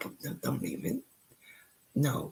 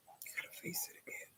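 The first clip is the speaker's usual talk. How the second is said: softer and faster, almost gone; fading whisper; 20 dB quieter, faster than usual. Real, not acted. Repeating to herself about the fight, faint whisper. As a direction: let the words die away under the breath.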